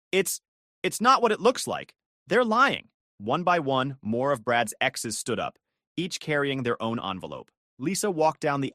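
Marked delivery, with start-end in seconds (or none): 0.1-2.9 nervous stammer. A narrator's reading. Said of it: "Brad stammered".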